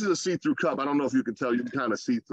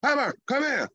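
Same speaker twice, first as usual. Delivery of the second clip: "Pepper, come here." pitched up and pushed out with authority; father showing his son how to call the dog; like you mean it; 8 semitones higher than usual. Shown as a demonstration. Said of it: "say it like you mean it".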